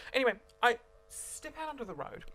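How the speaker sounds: usual speaking voice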